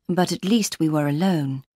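plain reading voice

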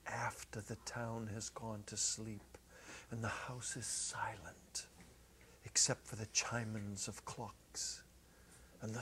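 soft whispered narration; whispering, the house asleep. A narrator's reading. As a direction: hush the night scene. Quiet night narration.

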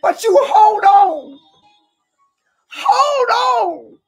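high, tearful shouted preaching; crying while preaching. Real, not acted. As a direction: preach through real tears. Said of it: "I'm trying not to cry".

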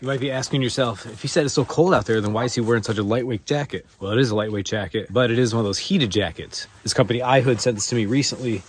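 ordinary talk, nothing marked

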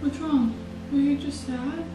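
usual speaking voice

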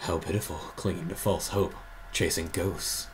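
low, soft shadowy villain; quiet, deep villain read; shadow voice. Acted. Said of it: "Do your shadow voice".

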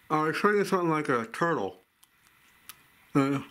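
talking with teeth on chocolate; candy between teeth; biting into candy. Real, not acted. Seen 0: Teeth clamped on chocolate candy held at lips throughout.

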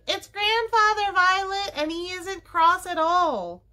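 put-on high, loud, excited child's shout. A narrator's reading. Benny hurrying into Violet's room, shouting. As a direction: shout the big news, drawing out the end. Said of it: "and shouting".

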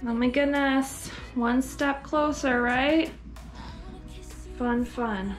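usual speaking voice

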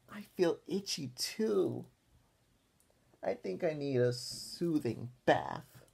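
put-on slow, pleading little-girl voice; milk it like a pretend invalid; whiny D W. D W asking grandma for a bath, slow and whiny. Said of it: "asked D W".

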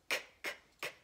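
"K, k, k" as a whispered unvoiced k k k; k sound. Shown as a demonstration. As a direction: breathe out "K, k, k" as bare k sounds. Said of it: "what sound does that make".

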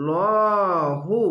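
লহু drawn out very slowly with a full, heavy লাম; heavy lam recitation. Shown as a demonstration. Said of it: "মোটা করা হবে". (মোটা করে টেনে লহু)

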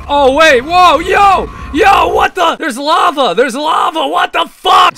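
high, loud astonished shouts; shocked yelling. Real, not acted. Gamer seeing lava appear suddenly, shocked yelling. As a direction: yell in disbelief at the chaos.

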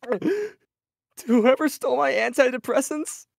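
high, loud, slow hammed-up crying; mock sobbing. Vash fake-sobbing a message to the antidepressant thief. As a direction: wail it like a fake tearful meltdown. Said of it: "in a sobbing slash crying voice".

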